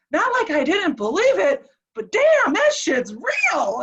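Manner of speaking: high, loud burst on damn, then slow; retold amazement. Patient telling wife it was all real, amazed. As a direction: imitate someone emphatically astounded.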